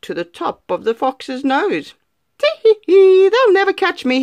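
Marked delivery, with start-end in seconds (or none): 2.4-4.2 high, loud giggling boast; gleeful cackle. Acted. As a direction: crow with cocky glee. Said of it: "he cried".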